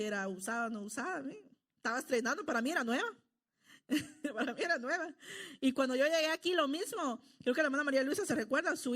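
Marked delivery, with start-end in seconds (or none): 3.9-5.1 laughs through the words, fondly amused; a real happy laugh as she repeats it. Real, not acted. Laughing at own childhood memory.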